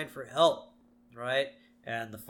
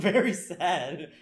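laughing; 5 semitones higher, 6 dB louder, slower than usual. Pretend. Repeats with laugh.

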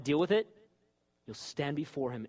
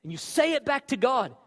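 loud, high preacher's delivery; fired-up sermon voice; full-force preaching; 9 semitones higher, 9 dB louder than usual. Acted. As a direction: preach it out to the whole room.

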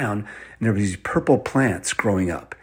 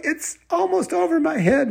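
put-on very high child's squeal; tiny frightened kid voice; panicking little girl. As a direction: cry out in high-pitched mock alarm. Mamie fears the rising water.

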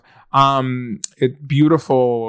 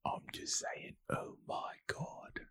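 whispering; 25 dB quieter than usual. Real, not acted. Whispered aside.

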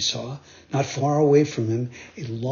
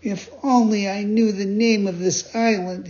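high, wondering puppet voice; Pinocchio voice; 6 semitones higher than usual. Acted. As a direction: muse aloud in a high boyish voice. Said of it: "he said to himself".

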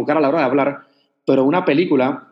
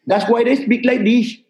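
high-pitched imitation Indian English; mock Indian-accented English, pitched higher; 7 semitones higher than usual. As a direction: put on an Indian accent, tongue up at the palate. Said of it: "I'm from India".

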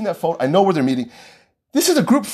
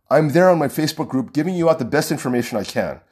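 ordinary talk, nothing marked

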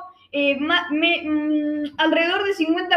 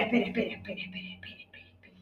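softer and softer until a whisper; fading to whisper; 8 semitones lower, 13 dB quieter, much faster than usual. Acted. Player cutting in, fading to a whisper. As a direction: let the repeated word die away to breath.

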